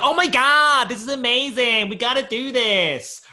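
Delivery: high, loud, gushing American-style mock excitement; hammed-up impression of an excitable friend; Monica Hathaway. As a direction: squeal it high, stretching the final words. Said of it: "Awful impression. She sounds nothing like that".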